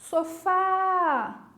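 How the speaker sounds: drawn-out call with FÁ held long and high. Shown as a demonstration. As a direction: call "Sofá" as if across a river. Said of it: "Vou gritar pra vocês".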